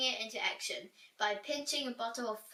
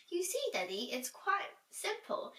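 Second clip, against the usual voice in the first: put-on girl voice; 8 semitones higher than usual. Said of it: "said Una faster than the speed of light".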